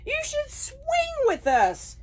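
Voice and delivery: high chirpy chorus; high bird voices. Acted. Birds inviting Jim, high chirpy voice.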